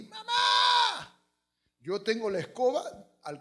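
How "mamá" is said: high, long shout for mother; hammed-up piercing call; mock child's scream. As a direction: yell for help like a kid, drawn out. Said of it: "grita nomás".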